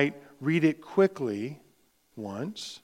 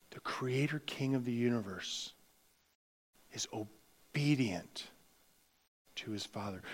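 awed whisper; 3 semitones lower, 12 dB quieter than usual. Acted. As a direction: breathe it like a sacred secret. Preacher whispers the key truth.

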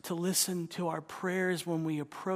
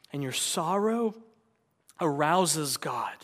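normal talking voice

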